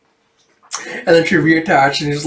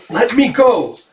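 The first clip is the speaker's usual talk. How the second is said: struggling cry; 9 semitones higher than usual. Acted. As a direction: yelp it out while pinned down. Yoko pinned down, struggling to escape.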